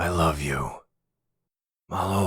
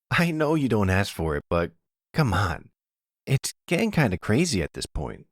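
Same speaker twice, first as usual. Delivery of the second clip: put-on a higher voice for a young man fussing over his mom; lightly played son, half teasing, half worried; lightly voiced son; 7 semitones higher than usual. Son checks on mother.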